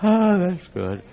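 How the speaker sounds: mock Peter shrugging it off; lukewarm Peter. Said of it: "And Peter said".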